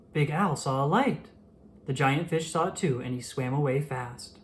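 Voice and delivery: usual speaking voice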